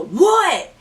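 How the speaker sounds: high, swooping what; irritated call. Retold or imitated. Drake answers a knock, irritated call. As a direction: imitate someone interrupted behind a door. Retold answer through a door.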